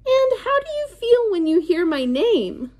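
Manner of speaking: high character voice. Acted. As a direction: ask it like a cartoon character to a child. Virus character asks children.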